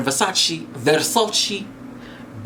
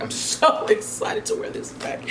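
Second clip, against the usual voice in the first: breathy, much higher, laughing through it; giggly high excitement; giggling; 4 dB quieter than usual. Real, not acted.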